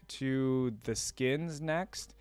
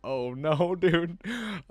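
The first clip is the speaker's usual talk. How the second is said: laughing dismay; 7 semitones higher, 5 dB louder, much slower than usual. Real, not acted. Laughing reaction to story.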